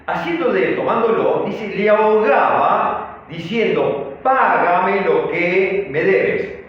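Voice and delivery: loud, drawn-out Bible reading; scripture reading. A narrator's reading. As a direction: proclaim the verse with slow, forceful emphasis. Reads the scripture verse aloud.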